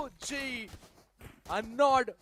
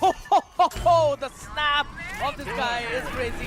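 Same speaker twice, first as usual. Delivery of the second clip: excited laughing; 8 dB louder than usual. Real, not acted. Caster laughs at a great play.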